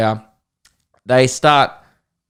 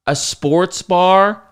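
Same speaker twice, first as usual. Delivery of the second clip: slow, higher mock-incredulous question; condescending; 3 semitones higher, 3 dB louder, much slower than usual. American passenger hearing about a sports bar, slow mock condescension. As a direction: imitate someone drawing it out condescendingly. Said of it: "been so condescending".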